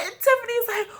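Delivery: ordinary delivery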